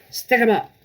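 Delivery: loud, high challenge; bold shout. Acted. Matilda bursting in on the burglars, shouting high and loud.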